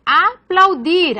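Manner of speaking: "Aplaudir" slowly broken into syllables; "Aplaudir" split, keeping pl together; syllable division. Shown as a demonstration. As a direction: say "Aplaudir" as a-plau-dir, drawn out. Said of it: "no se separa".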